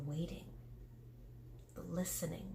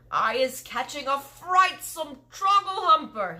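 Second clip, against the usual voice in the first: cried out; 9 semitones higher, 9 dB louder than usual. Acted. Giant telling Sophie the catch, horrified loud cry. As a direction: cry out in horror. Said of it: "he cried".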